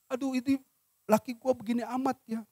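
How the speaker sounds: fretting wife. Acted. Voices a wife fretting about husband.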